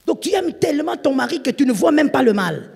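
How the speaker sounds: loud, high pulpit exhortation; urgent warning to wives; full-force preaching. Acted. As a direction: thunder it at the congregation.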